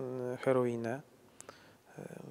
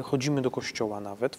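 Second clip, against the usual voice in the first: ordinary delivery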